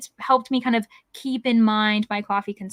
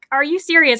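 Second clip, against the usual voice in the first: exasperated; 4 semitones higher, 6 dB louder than usual. Retold or imitated. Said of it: "And I'm like".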